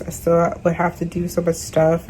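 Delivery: usual speaking voice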